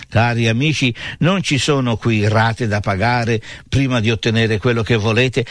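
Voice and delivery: normal talking voice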